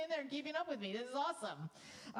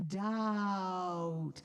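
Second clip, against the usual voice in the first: doubt dragged out slowly and louder; said really slowly; 4 semitones lower, 5 dB louder than usual. Shown as a demonstration. Conductor showing how doubt sounds said slowly. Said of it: "say the word really slowly".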